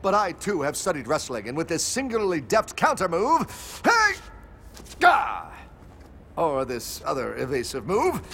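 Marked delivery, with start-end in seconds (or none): 5.0-5.5 pained gah. Acted. Hero thrown in a wrestling move.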